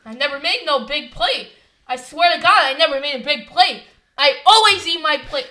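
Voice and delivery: worked-up, emphatic self-defence; pissed-off retold protest. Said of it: "I was getting pissed off".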